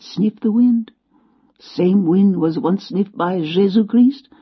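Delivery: put-on slow, grand French-accented musing; old Frenchman savouring the wind, French accent. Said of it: "He had a French accent".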